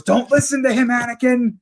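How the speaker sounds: high-pitched acted plea; imitating Mace. Mace fighting Palpatine warns Anakin, imitated high-pitched urgent plea. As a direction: act out an urgent warning mid-fight. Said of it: "you hear Mace fighting Palpatine, and he goes".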